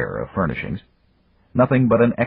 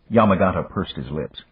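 ordinary delivery, nothing marked

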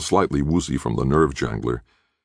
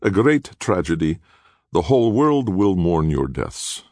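raised-pitch theatrical lament; dramatic sighing; 5 semitones higher than usual. A narrator's reading. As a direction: mourn them with showy false regret. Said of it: "Castiglione sighed dramatically".

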